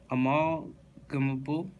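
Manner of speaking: "Amalgamable" stretched slowly with a rising end; drawn-out, questioning. Real, not acted. Reader retrying an odd word from the list, slow and questioning. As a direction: sound out "Amalgamable" in pieces, as if unsure.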